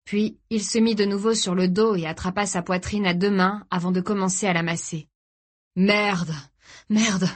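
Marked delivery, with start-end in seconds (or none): 5.8-7.3 panting, short-winded swearing; gasped curse. A narrator's reading. Father clutching his chest on the sand, gasping. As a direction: gasp it out. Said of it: "haleta Tony".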